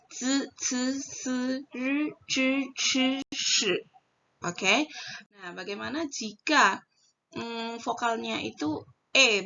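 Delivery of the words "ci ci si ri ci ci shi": ci, si, ri, shi with a closed "e" sound, not "ee"; correct syllable endings. Shown as a demonstration. Teacher correcting the I reading for learners, pronunciation demo. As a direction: chant ci, ci, si, ri, ci, ci, shi higher, evenly spaced.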